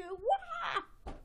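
sudden high yelled "wah"; high mock wail. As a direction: let out a cartoon cry. Character leaps during performance.

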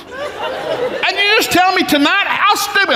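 high, drawn-out exasperated scolding; retold angry outburst. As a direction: unload on the kid in mock outrage. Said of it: "I unload verbal".

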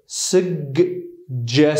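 suggestion drawn out very slowly, 'sug-jes', with both g and j sounded; very slow pronunciation. Shown as a demonstration. Teacher showing both sounds slowly, ending on a rise. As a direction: stretch suggestion, hitting the g and then the j. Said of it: "هم صدای ج و هم صدای گ".

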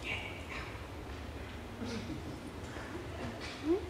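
hushed, drawn-apart whispered words. Retold or imitated. God answers a praying teenager with two words, whispered. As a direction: whisper it with a long pause between words. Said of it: "a whisper".

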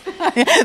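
a quick bright burst of laughter; short real laugh. Real, not acted. Host laughs at herself while looking for the rice. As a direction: laugh at yourself, caught out.

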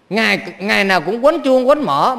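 ordinary talk

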